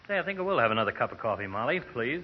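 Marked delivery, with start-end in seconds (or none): none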